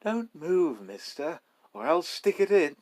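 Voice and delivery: a sweet voice with a knife behind it; sweet but menacing. Acted. Robber to the narrator, sweet but threatening. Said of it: "a sweet voice".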